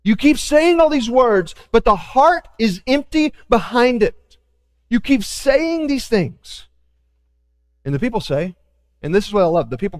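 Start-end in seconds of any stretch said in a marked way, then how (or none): 0.0-6.7 God's frustrated voice. Retold or imitated. God rebuking his people for empty words, pleading. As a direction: press the hurt of being ignored. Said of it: "he's like".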